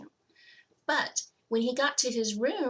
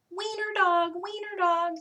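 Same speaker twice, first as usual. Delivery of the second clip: high, mocking schoolyard chant; sing-song it like bullies teasing; high teasing chant of the other dogs; taunting dogs; 10 semitones higher than usual. A narrator's reading. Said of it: "they called him".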